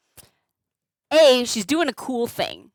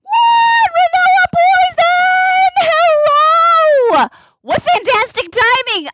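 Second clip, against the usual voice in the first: very high, loud shouted welcome; excited raid greeting; 7 dB louder, slower than usual. Real, not acted. Streamer greeting Renoa's raid, thrilled shouting welcome. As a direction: burst out with delight at the surprise raid.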